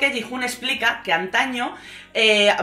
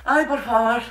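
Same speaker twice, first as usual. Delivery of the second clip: breathless laughter through the words; can't stop laughing while talking; 3 semitones higher than usual. Real, not acted. (hablando entre carcajadas reales)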